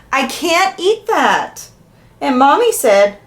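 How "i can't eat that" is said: mild higher, drawn-out whine of protest; crying protest. A narrator's reading. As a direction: read it as a lightly acted tearful complaint. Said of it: "I cried and cried and said".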